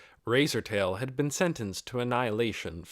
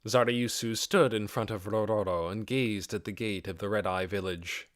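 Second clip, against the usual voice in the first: ordinary delivery, nothing marked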